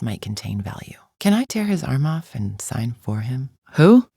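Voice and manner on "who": loud, high one-word question; sharp outburst. Acted. Champion questioning the mercenary, sharp outburst. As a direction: fire it out loudly and high.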